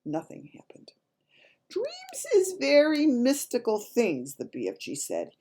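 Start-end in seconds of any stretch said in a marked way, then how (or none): 1.7-4.4 high, slow, drawn-out storybook voice; giant character, marvelling. Acted. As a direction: pronounce it slowly with grand wonder. Reader voices the BFG.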